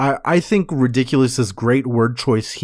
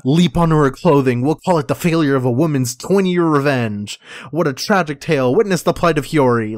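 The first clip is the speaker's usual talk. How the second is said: fast gloating; faster than usual. Retold or imitated. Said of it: "on his deathbed".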